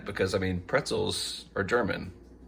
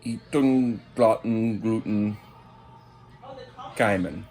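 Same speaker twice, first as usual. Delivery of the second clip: mock German accent; 2 semitones higher, 4 dB louder, slower than usual. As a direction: fake German gibberish, louder. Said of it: "As they say in German".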